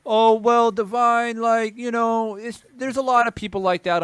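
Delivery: high, put-on voice of a dismissive viewer; mocking high voice. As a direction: sneer it back at them, pitched high. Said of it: "And people say".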